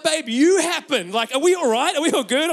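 loud, high, mock-frantic comeback; comic freak-out at full volume; exasperated husband. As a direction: throw it back at the wife, rattled. Rattled husband answers back loudly.

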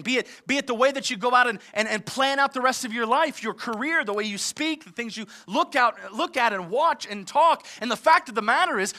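ordinary talk, nothing marked

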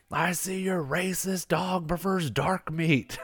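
high, slow put-on taunting voice; imitated mocking taunt. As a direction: play the buddy needling the handler, drawn out. Retold taunt from his buddy Stu.

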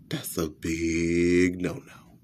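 hushed, slow whisper of disapproval; whispered aside. Acted. Comic tells audience a warning in a whisper. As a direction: breathe it like a secret warning.

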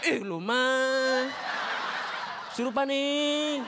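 very slow, stretched-out whine; mock whining. Ignored possessed person begs for attention, mock whining. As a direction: drag the words out in a sulky complaint.

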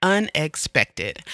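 said slowly and deliberately to get through it; slow the word down, steadying yourself; pushing through a real lump in the throat, slowly; slow, careful. Real, not acted. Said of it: "that word almost got me there".

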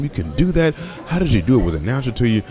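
normal talking voice

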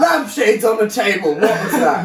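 high, loud silly British voice with laughter; hammed-up British nonsense, cracking up; pretend British. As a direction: shout absurd mock-British kill comms. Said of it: "pretend to be British and just start saying".

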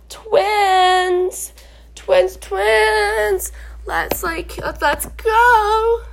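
high, stretched-out mock excitement; excited greeting. Runner greeting friends in the same race, gushing. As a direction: gush like you've spotted friends on the road. Acted greeting of fellow believers.

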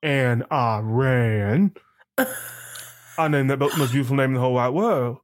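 put-on movie-character voice with a Southern accent; Forrest Gump. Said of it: "trying to have a Forrest Gump moment".

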